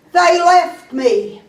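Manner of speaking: high, slow mock lament; forlorn man. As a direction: play a jilted man wailing his answer. Suicidal man answers the lady.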